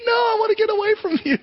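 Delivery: put-on very high, fast child voice backing away; scared kid. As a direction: play a frightened little kid pulling away. Scared kid answers Dracula.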